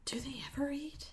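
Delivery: whispered aside; hushed joking aside. Real, not acted. Aside joke about the NPCs.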